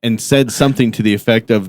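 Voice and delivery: ordinary talk, nothing marked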